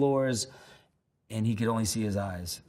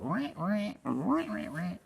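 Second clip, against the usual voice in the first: high rising mock violin wah wah; imitated violin wail; 9 semitones higher, 3 dB quieter, slower than usual. Recalling [name]'s violin on stage, high mock wailing. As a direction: wail like an eerie electric violin. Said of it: "She was like".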